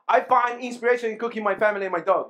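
"I find inspiration in cooking my family and my dog" rushed with no breaks; run together fast. Shown as a demonstration. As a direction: rattle it off without a single pause. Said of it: "Without comma".

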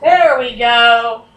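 loud, drawn-out there we go; satisfied. Real, not acted. Player cheering as the door opens, loud and slow. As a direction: stretch it out in triumph.

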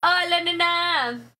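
hammed-up pickup-line flirt, drawn out slowly; mock fuckboy greeting, stretched slow and loud. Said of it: "Haz la voz de Fuckboy".

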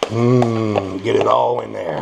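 ordinary talk

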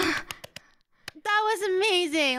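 gushing praise. Real, not acted. Praising partner's song.

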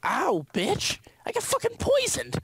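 high pitched outraged yelling. Real, not acted. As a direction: shriek in mock outrage. Poisoned in game, yelling.

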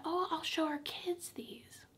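slow, high, tearful whisper; choked up. Real, not acted. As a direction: voice thins to a soft, drawn-out whisper, near tears. Tearful over lost keepsakes for children.

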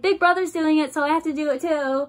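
high, loud copycat little-sibling voice; mock eager sibling. Mocking herself for copying big brother, mock eager sibling.

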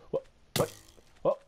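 normal talking voice

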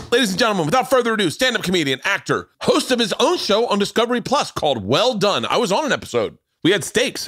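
high, bright hype-man build-up over the music; announcer intro. Acted. Host hyping guest to the audience, announcer voice. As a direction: sell the guest like a ring announcer.

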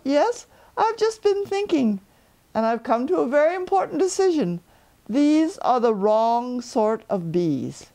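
high, light bear voice up in the sky; a cheerful little bear calling down; Pooh Bear. Acted. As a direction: Pooh calling down from his balloon.

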